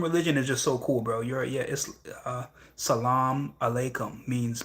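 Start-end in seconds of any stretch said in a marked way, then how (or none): 2.7-4.3 Salam alaikum drawn out slowly, each syllable spelled out; slow careful pronunciation. Shown as a demonstration. Practicing the greeting for the chat, said slowly and carefully.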